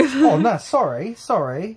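mock apology. Boyfriend mocks the stranger's apology, high and hammed-up. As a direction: ham up the fake sorry.

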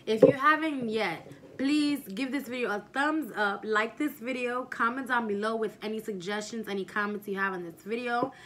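loud, upbeat YouTuber sign-off; vlog outro. Acted. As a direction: pump up the outro energy.

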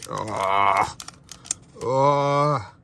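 loud excited oh. Real, not acted. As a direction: burst out with real excitement. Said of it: "I'm getting too excited".

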